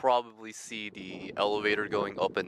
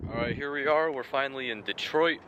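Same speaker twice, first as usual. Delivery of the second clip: excited; 6 semitones higher than usual. Real, not acted. Arriving at last in Detroit.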